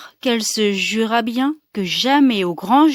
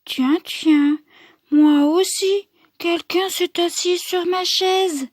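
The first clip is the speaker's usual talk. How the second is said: higher, lightly voiced Mama Bear; a middle-sized bear voice, gently raised in pitch; mild mother bear murmur; 7 semitones higher than usual. Mama Bear seeing her cushion flattened, mild high murmur. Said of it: "Elle murmure".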